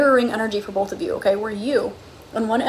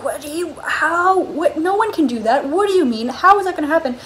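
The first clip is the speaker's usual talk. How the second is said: higher-pitched, baffled protest as Arthur; acted disbelief; 5 semitones higher, 3 dB louder than usual. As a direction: sputter questions in mock astonishment.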